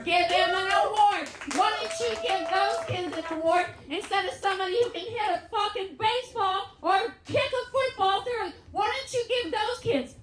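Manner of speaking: high-pitched comic indignation; indignant rant. Acted. Mother demanding awards for troubled kids, indignant rant. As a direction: plead the case in mock outrage.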